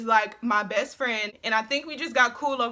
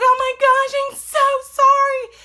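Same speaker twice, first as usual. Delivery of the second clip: much higher, louder, slow sing-song sorry; mock gushing apology; 13 semitones higher, 9 dB louder, slower than usual. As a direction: overplay a fake apology. Apologizes after spilling drink.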